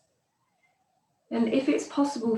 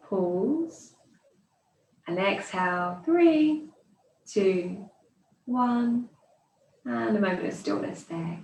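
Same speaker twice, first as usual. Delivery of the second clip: pace the class through the breath, landing hard on the top count; guided breath count; slower than usual. Acted. Yoga teacher counting students through slow belly breaths, calm and even.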